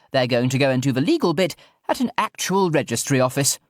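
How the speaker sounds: put-on high, plummy posh old lady; a grand eccentric aristocrat. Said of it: "in her loudest posh person voice".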